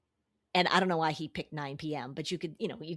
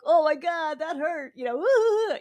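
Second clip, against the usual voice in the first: high and loud, laughing it off; playful yelp after getting hit; 9 semitones higher, 7 dB louder than usual. Retold or imitated. Buddy hit in touch football, yelping high and loud but delighted. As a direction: cry out, delighted rather than hurt.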